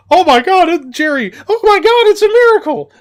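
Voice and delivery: high, loud shocked yell; frantic Vince shout. Retold or imitated. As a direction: scream it as a miracle. Voicing Vince's commentary.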